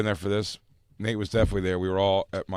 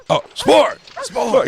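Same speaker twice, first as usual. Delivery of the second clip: high loud mock call of the dog's name; 9 dB louder, much slower than usual. Friend calling his dog back. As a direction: shout for the dog in a high squeal. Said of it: "He's like".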